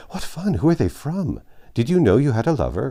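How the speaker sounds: high, loud, fast giggly excitement; eager squeal of surprise; excited girls crying out. A narrator's reading. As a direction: girls fluttering round with curiosity. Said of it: "cried the girls, fluttering ... curiosity and surprise".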